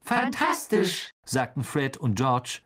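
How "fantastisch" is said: high, drawn-out delight; thrilled. A narrator's reading. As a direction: savour it in high excitement. Twins thrilled by forbidden alley.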